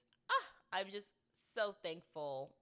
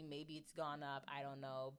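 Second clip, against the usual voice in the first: ordinary talk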